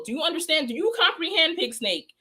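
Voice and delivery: high, mocking questions; condescending. Real, not acted. Host lecturing Pig Snake, high and condescending. Talking down to a rival.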